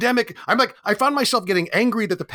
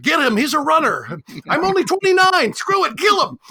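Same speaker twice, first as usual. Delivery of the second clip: high, loud, hammed-up shout; mock hunter yell; 10 semitones higher, 5 dB louder than usual. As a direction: bellow like a movie mob chasing a runner. Hunting runners in Logan's Run.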